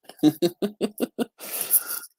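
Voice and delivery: loud, high burst of real laughter. Real, not acted. Amused at the drawing, a loud real laugh. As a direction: laugh out loud at your own joke.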